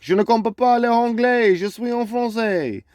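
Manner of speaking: high, hammed-up French voice pitched well up; mock French person. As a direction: play the baffled Frenchman who doesn't get it. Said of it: "that French person is still gonna stand there and be like".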